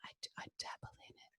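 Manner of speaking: fast breathy whisper. Real, not acted. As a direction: whisper it coyly. Coy whispered admission.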